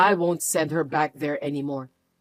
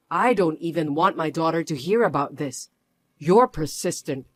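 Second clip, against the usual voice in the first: ordinary talk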